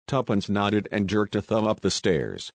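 ordinary delivery, nothing marked

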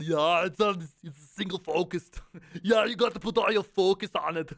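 loud, put-on action-star voice; hammed-up impression, breaking into laughs; Arnold. Streamer urges single focus, doing a hammy Arnold. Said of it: "Do Arnold?".

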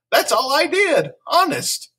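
put-on high, pleading child voice; protesting schoolgirl. A narrator's reading. Lulu explaining her day to the nurse, high earnest protest. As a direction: protest innocence in a high little voice.

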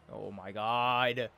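ordinary talk, nothing marked

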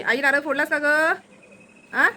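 a drawn-out call across the house. Real, not acted. Calling mother from another room.

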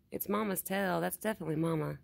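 ordinary delivery

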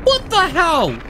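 sudden high "what"; shocked outburst. Real, not acted. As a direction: jump up in disbelief. Gamer shocked by sudden death.

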